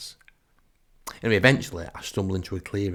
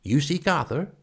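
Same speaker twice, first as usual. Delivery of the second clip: higher-pitched, portentous druid; 6 semitones higher than usual. Acted. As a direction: ask it like a mystic greeting a seeker. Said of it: "One of them beckons me forward".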